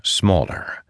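ordinary delivery, nothing marked

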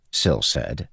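usual speaking voice

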